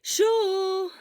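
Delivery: loud, high call to wake a sleeping friend. Acted. Friend shouting to wake a sleeping Shota, high and loud. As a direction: shout his name to rouse him.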